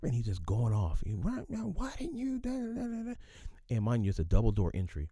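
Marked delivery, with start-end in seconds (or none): 1.1-3.1 mock yelling. Boss staging a rant at his worker, high mock yelling. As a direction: parody the boss going off. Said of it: "he's doing all this yelling".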